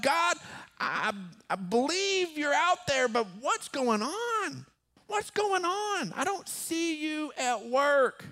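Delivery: high, slow exasperated pleading; frustrated, irritated. Acted. Preacher acting David asking God what's going on, frustrated. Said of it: "He's frustrated. He's irritated".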